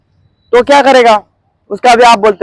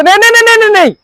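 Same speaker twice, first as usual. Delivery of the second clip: higher, eager mock salesman reassuring a doubter; hammed-up recruiter, higher voice; mock pushy recruiter; 8 semitones higher, faster than usual. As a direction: play the slick recruiter brushing off worries, pitched up. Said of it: "कुछ लोग बोलते".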